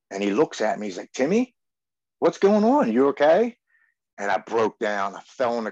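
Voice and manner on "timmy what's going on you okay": high, louder voice of a concerned father figure; worried older man. Retold or imitated. Father of a dead friend finding him crying, concerned. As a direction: call out to him, alarmed and caring.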